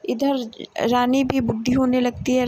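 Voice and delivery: ordinary delivery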